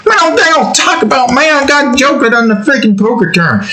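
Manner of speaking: high put-on whine at Batman; high indignant whine to Batman; whiny complaint. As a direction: protest like a wronged friend.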